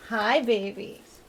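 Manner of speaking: slow, soft pet talk. Real, not acted. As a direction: coo it gently to the cats. Soft slow greeting to cats.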